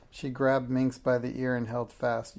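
ordinary delivery

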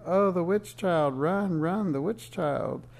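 high, wailing cries of alarm; narrated children's scream, high and drawn out. A narrator's reading. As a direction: cry out loudly as if fleeing.

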